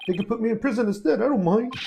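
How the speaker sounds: high, loud, urgent plea; acted self-sacrificing outburst; desperate pleading. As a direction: rise to a loud, desperate offer. Character offers himself in friend's place.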